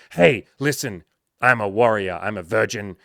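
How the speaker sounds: loud pumped-up declaration; shouting over music. Retold or imitated. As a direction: yell it over the doof doof. Client shouting over club music.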